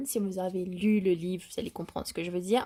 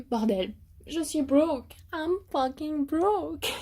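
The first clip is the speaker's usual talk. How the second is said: slow, higher, dramatic moan; mock despair; 6 semitones higher, slower than usual. Real, not acted. Vlogger lamenting her spending, slow mock despair. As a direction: wail it slowly, half-joking.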